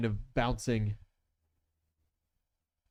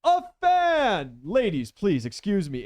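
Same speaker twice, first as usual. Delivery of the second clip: high, delighted gentleman villain greeting fans; gushing villain; 10 semitones higher, 8 dB louder than usual. Acted. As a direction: charm the ladies with theatrical delight. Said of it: "and he's like".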